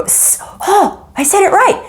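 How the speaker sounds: high, delighted self-surprise; pleased surprise. Retold or imitated. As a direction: gasp and realize you got it right. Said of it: "Every now and then I go".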